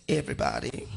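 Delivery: ordinary talk, nothing marked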